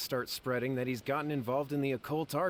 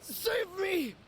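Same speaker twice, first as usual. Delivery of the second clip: high, desperate pleading; begging to be saved in panic; frightened cry for help; slower than usual. Acted. Brother in peril begs for rescue, panicked.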